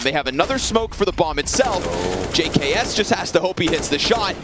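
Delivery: rising play-by-play hype; esports caster. Real, not acted. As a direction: call the round as it builds. Live play-by-play build.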